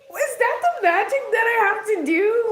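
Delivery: high, loud squeal of disbelief; exaggerated incredulous question, loud and squeaky; mock amazement. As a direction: act out being floored, voice shot way up. Acts out own amazed reaction.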